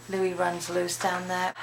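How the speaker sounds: ordinary talk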